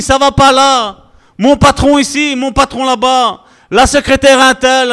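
a believer moaning about his boss in prayer; mock whiny prayer. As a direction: whine out your list of woes to God. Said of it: "À la place de dire".

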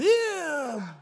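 high, drawn-out creature whine; Gollum. Acted. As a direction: twist the voice into a squealing little creature. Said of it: "from the worship leader into Gollum".

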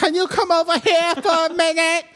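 high-pitched, speech-impeded clergyman; silly squeezed-up priest voice through laughter; Princess Bride priest. Acted. Voicing Gina calling him over, absurd high priest voice. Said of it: "Is that still the priest from The Princess Bride?".

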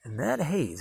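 ordinary talk, nothing marked

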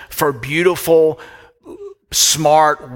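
tearful. Real, not acted. Preacher moved mid-sermon.

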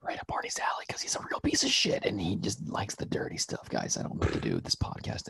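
hushed aside to the audience; whispering. Real, not acted. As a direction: whisper it behind his back. Aside to listeners about co-host.

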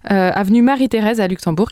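ordinary talk

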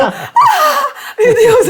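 high, loud panicked cry of Oh my God; panicked gasp. Retold or imitated. Reporter panicking in the interrogation room, high loud cry. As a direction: shriek it out in sudden panic. Panics seeing men behind mirror.

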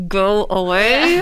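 drawn-out, slow dismissal through a smile; mock-polite brush-off, stretched out; gritted smile. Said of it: "the gritted smile that you hope".

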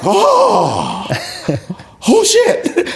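high-pitched, stunned exclamation; can't believe it worked, acted; acted shock. Student sees his takedown actually worked, acted shock.

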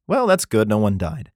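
ordinary delivery

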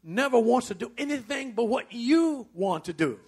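ordinary delivery

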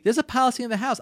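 ordinary talk

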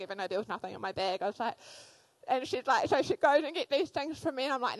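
ordinary delivery

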